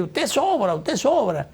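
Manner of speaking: high-pitched, half-shouted dismissal, quoted; mild mock shouting. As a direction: imitate an arrogant senator's outburst.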